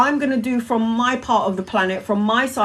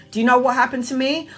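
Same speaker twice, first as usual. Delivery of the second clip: normal talking voice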